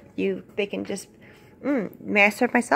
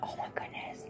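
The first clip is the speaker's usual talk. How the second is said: unvoiced whisper; a hushed aside under the game; 17 dB quieter than usual. Real, not acted. Child whispers aside in pretend play.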